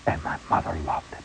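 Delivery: normal talking voice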